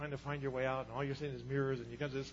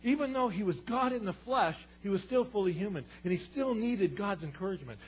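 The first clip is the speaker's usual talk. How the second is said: loud, rising sermon climax; preaching at full force; 5 semitones higher, 16 dB louder than usual. Acted. Preacher to his church, building to a loud shout. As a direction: preach it out to the congregation.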